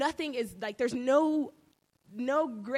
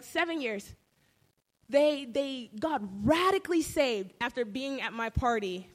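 usual speaking voice

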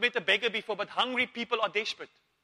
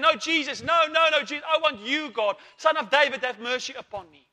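higher, louder desperate cry; shouting louder; 4 semitones higher, 6 dB louder than usual. Retold or imitated. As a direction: yell for Jesus over everyone. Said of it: "He shouted more".

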